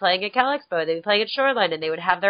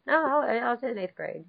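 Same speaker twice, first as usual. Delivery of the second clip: ordinary talk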